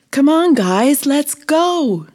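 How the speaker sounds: high, loud call through the house. A narrator's reading. Bee calls her friends through the house, loud and high. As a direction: call everyone to hurry along. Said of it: "she called through the house".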